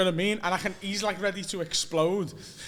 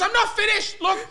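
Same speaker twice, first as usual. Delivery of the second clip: higher-pitched indignant flatmate; mock angry rant; 6 semitones higher, 4 dB louder than usual. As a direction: tell them off in mock outrage. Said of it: "he's proper screaming at me".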